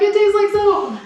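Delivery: put-on high, loud stubborn child; stubborn kid. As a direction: insist back like a kid. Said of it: "I'm like".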